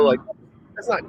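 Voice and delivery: normal talking voice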